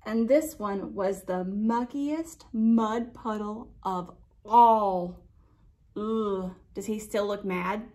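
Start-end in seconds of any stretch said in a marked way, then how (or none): none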